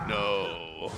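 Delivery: deep, soft vampire no; a low, hushed Transylvanian no. Retold or imitated. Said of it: "He puts on his vampire voice".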